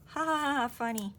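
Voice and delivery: loud fake ha ha ha, high; mock-laughing at her own flop; sarcastic laugh. Real, not acted. Crafter laughs at a paper flip gone wrong, sarcastic.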